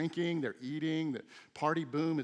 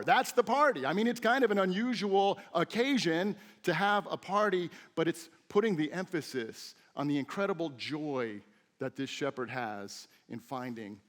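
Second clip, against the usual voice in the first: normal talking voice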